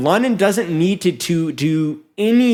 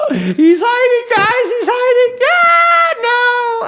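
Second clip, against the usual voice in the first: much higher, loud, gasping yell; gasping shriek; 4 dB louder, slower than usual. Real, not acted. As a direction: shriek it like the game's on the line. Caster screaming at clutch hide.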